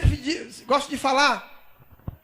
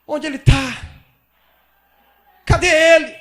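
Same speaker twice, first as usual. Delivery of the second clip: louder, pleading shout for the lost Lord; desperate crying out; 5 dB louder than usual. Acted. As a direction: cry out like a woman searching in anguish. Maria voiced searching for Jesus. (Maria gritando desesperada procurando Jesus)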